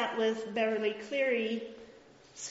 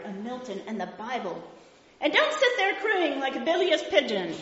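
loud, higher, indignant lecture; indignant outburst; 3 semitones higher, 5 dB louder than usual. Acted. Professor Higgins scolding the Cockney flower girl, indignantly. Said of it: "Professor Higgins explodes in indignation".

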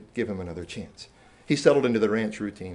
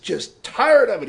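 imitating a fed-up boy, high and loud; frustrated outburst; 11 semitones higher, 11 dB louder, slower than usual. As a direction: burst out with drawn-out, loud frustration. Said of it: "he was frustrated".

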